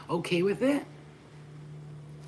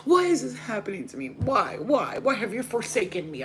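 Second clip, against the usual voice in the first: self-pitying wail; 4 semitones higher than usual. Retold or imitated. Acting out her own self-pity.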